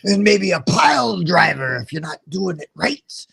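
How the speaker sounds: put-on high wrestler snarl at a child; sweet teacher turned wrestler, growling threats; Macho Man kindergarten teacher. Dad showing his impression of the teacher as a wrestler, high hammed-up snarl. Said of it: "my version of her was always like".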